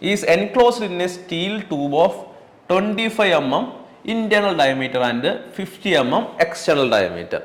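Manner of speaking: very slow, word by word; slow dictation. Shown as a demonstration. Teacher dictating a definition to students, very slow. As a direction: spell out the definition for notes. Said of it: "dictating problem text".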